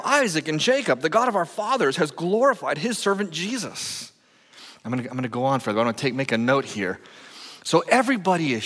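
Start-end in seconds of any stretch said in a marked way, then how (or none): 0.0-4.1 raised, measured Bible reading; proclaiming scripture to the congregation. Acted. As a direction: read the passage out with weight. Reads scripture aloud.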